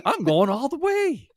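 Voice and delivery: goofy boast rising to a high stretch; imitated gleeful player. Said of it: "he was like".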